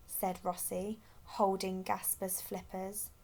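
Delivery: ordinary delivery, nothing marked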